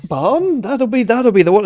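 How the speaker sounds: high, pleased posh farmer; relieved gentleman farmer. Acted. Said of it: "this farmer's really posh".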